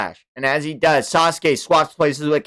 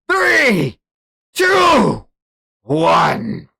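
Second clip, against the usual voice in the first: much higher, louder counting, slow at first; shouted countdown; 6 semitones higher, 7 dB louder, much slower than usual. Acted. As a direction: bark each number like a threat. Countdown ends.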